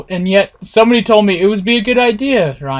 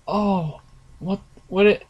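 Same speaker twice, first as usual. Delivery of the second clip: ordinary delivery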